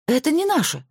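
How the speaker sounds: high indignant cry; protest, shooting up in pitch; indignant exclaim. A narrator's reading. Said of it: "возмущённо воскликнула Зоя". (возмущённо, высоко вскрикнув)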